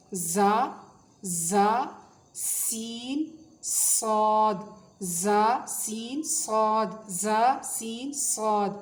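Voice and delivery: زا سین صاد hissed like a whistle; whistling letters. Shown as a demonstration. Teacher demonstrating whistling letters to pupils. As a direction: let each letter whistle like a pressure cooker. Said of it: "سیٹی کی طرح آواز نکالیں".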